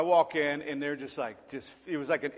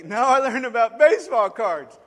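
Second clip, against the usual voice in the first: put-on loud, higher cheeky kid voice; a kid talking back, louder and higher; bratty kid reply; 6 semitones higher, 9 dB louder than usual. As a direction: answer like a smart-aleck kid. Kid's cheeky answer.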